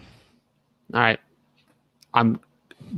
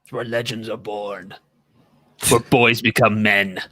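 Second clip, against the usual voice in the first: slow, dramatic movie trailer narrator; trailer guy voice. Acted. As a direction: lay it on like an epic film trailer. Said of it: "My trailer guy voice".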